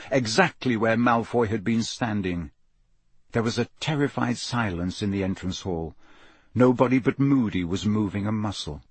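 ordinary delivery, nothing marked

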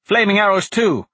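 loud urgent warning; alarmed shout. Acted. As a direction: call it out in alarm. Enemy attack warning.

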